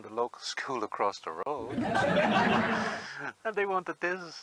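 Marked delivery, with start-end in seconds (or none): 1.8-3.4 loud laugh. Real, not acted. Laughing at chaotic shop story.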